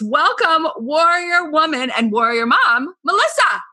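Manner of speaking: excited welcome. Real, not acted. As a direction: greet her with big enthusiasm. Enthusiastic welcome of guest.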